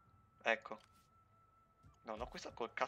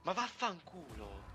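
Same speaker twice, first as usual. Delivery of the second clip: furious yelling at the screen; italian angry cursing; 10 semitones higher, 12 dB louder, slower than usual. Real, not acted. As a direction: scream the curses, losing it. Furious at game loss.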